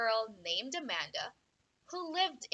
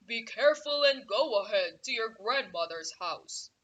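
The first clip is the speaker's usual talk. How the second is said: woodcutter voice; 5 dB louder than usual. Acted. Woodcutter advises girl.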